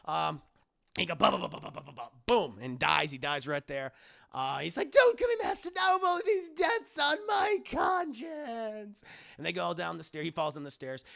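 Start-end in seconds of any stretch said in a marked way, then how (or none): 1.2-2.1 rapid run of bumping syllables; imitated tumbling noise. Renfield bumping down the stairs, imitated thuds. 4.9-9.0 high, loud, drawn-out lament; hammed-up begging, stretching the final words; wailing, pleading Renfield. Renfield begging Master to spare him, mock wailing. Renfield begs Dracula for mercy.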